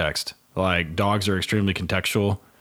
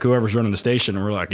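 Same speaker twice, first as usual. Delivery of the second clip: ordinary delivery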